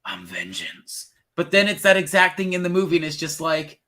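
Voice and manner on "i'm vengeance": low, slow Batman growl; a brooding mock Batman whisper-growl; imitating trailer Batman. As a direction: do the deep trailer Batman line. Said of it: "And he's like".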